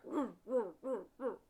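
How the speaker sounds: loud, booming woofs like a big dog; deep, loud barking imitation. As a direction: bark it out like a burly pit bull. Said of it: "big, deep bark".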